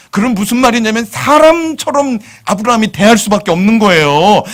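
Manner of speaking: usual speaking voice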